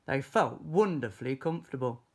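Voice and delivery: ordinary delivery, nothing marked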